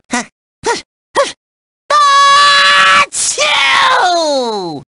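gasping build-up into a giant sneeze; huge sneeze. Acted. Host's sneeze scatters the papers, huge sneeze. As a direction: hitch the breath, then explode high. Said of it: "I promise not to sneeze".

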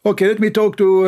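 high, sing-out mock phone chat; chatty phone caller. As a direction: imitate someone busily greeting people on the phone. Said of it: "he's talking to everybody".

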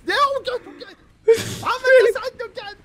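high, whiny fake sob over the fall; mock crying. Teasing his friend, hammed-up crying over the fall. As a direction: pretend to wail about falling.

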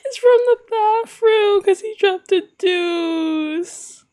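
high, drawn-out squeal of hilarity; laughing wail. Real, not acted. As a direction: repeat it slowly, helpless with laughter. Repeats it, breaking into laughter.

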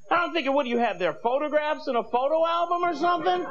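normal talking voice

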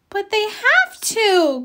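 high, slow pleading protest; a child begging it not be so; cried out. A narrator's reading. Franklin protesting to his mother, upset and pleading. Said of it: "cried Franklin".

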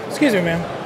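normal talking voice

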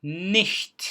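"Nicht" high and loud, short i and hissed CH. Shown as a demonstration. Teacher demonstrating C H on an example, emphatic careful pronunciation. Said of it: "sadece H'yi okuyoruz".

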